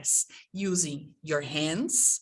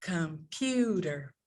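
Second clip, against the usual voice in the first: slow "Computer" with the PU drawn out; com-PUUU-ter, softly drawn-out "Computer"; stressed syllable demo; 4 semitones lower, 6 dB quieter, slower than usual. Shown as a demonstration. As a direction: stretch the middle syllable of "Computer". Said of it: "in the stress syllable".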